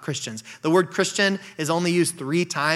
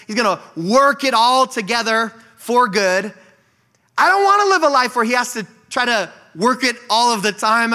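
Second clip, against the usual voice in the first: raised, louder preaching voice; fervent preaching; 5 semitones higher, 4 dB louder than usual. Acted. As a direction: preach it out to the congregation.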